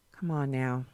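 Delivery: ordinary delivery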